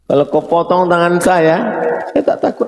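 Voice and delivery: very high-pitched, fearless challenge; defiant quote. Acted. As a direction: voice a zealot refusing to be afraid. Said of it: "kata dia".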